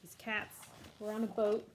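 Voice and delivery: normal talking voice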